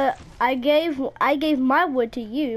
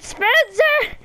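high-pitched exclamation of a name; exasperated cry; 9 semitones higher than usual. Real, not acted. Gamer calling out a teammate by name, exasperated cry.

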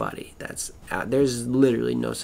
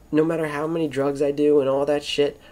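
ordinary talk, nothing marked

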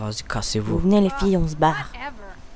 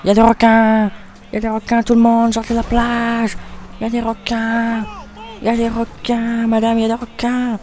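loud shark alarm yell; shouting warning; 5 semitones higher, 4 dB louder than usual. Pretend. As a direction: yell it to the whole beach. Beach guard warns of sharks.

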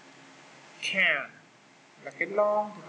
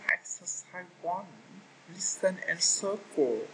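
slow instruction; slower than usual. Shown as a demonstration. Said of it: "teacher reads instruction slowly".

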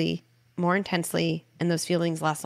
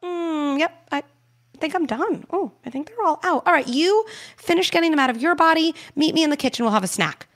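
breezy and higher, as if nothing happened; nonchalant, playing it cool; 10 semitones higher, 5 dB louder than usual. Shown as a demonstration. Parent walking out of the room casually after the shouting. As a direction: shrug it off like it's no big deal. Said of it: "play it cool, totally nonchalantly".

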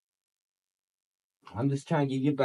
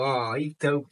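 ordinary talk, nothing marked

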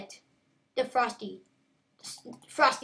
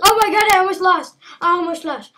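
high, loud panic; frantic gamer panic after nearly losing; panicked yelp; 6 semitones higher, 13 dB louder, faster than usual. Real, not acted. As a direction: shout it in relief at a close call. Panicking in a close game.

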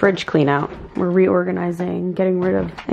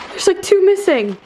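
shocked dismay; 10 semitones higher than usual. Real, not acted. Girlfriend discovers missing eggs, squealing in shocked disbelief. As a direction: squeal what the hell through a laugh.

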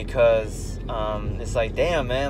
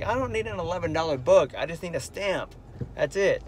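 exasperated; 5 semitones higher, 4 dB quieter than usual. Retold or imitated. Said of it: "I'm like".

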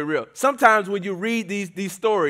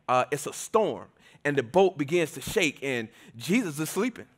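usual speaking voice

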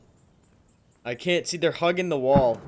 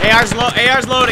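urgent shout; 8 semitones higher, 11 dB louder than usual. Real, not acted. Reloading under fire.

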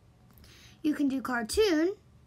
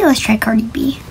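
whisper; 3 semitones lower, 14 dB louder than usual. Real, not acted. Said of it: "whispered aside".

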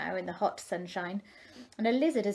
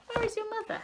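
high, light fish voice; curious little fish; 14 semitones higher, faster than usual. A narrator's reading. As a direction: ask brightly and innocently. Fish asks tadpoles.

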